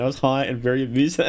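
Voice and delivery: normal talking voice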